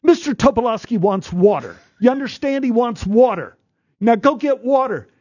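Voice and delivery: put-on high child's voice, insistent; a young boy bossing the adult around; an eleven-year-old ordering water; voicing the boy.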